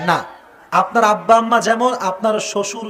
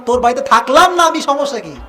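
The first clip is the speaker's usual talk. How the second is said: mock defiant wife; 4 semitones higher, 4 dB louder than usual. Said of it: "আর স্ত্রী কয়".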